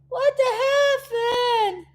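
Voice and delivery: high, slow disbelieving squeal; playful high-pitched what the heaven; mock disbelief. Real, not acted. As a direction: stretch it out, scandalized. Mock outrage at product seasons.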